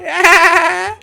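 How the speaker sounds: very high, loud wavering cackle; gleeful baby squeal. Pretend. Baby escaping dad, very high gleeful squeal. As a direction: squeal with laughter like a naughty toddler. Baby shrieking during the chase.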